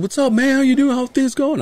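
high-pitched imitation of a chummy guy; put-on texting voice. Reading an old coworker's text aloud, mock chummy. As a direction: read the text out in a silly high voice. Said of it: "You were reading it like that".

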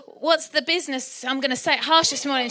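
ordinary delivery

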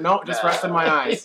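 laughing light mom voice; a hint of a drowsy mom; imitating mom. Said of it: "Cheryl would always say the same thing".

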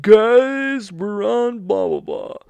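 loud, high hammed-up shout; over-the-top celebratory holler; mock excited announcement. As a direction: yell it out in mock triumph, dropping at the end. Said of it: "and I'll be like".

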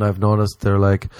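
normal talking voice

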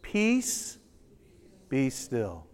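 hushed "Peace, be still" said together; quiet congregational echo; soft group recital. Shown as a demonstration. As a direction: lead the room softly in the words. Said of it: "Let's all say those three words together".

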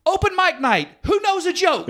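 high, chirpy announcement; sarcastic bail-out. Retold or imitated. Comic quitting the mess with a high chirpy announcement. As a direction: hand off the stage cheerfully defeated. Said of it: "I say".

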